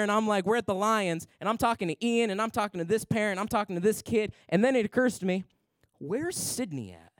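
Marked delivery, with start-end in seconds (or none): 6.0-7.1 low, slow, softer realization; half under the breath, slowly; sudden worry. Retold or imitated.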